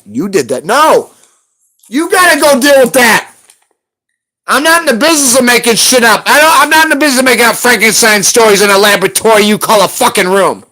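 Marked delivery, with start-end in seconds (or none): 0.6-3.3 higher, louder angry yell; angry outburst. Real, not acted. Ranting at a chat moderator. 4.5-7.2 raised angry repeat. Real, not acted. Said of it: "'Cause I'm not in the business of making shit up".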